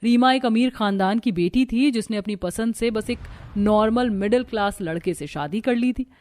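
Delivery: plain reading voice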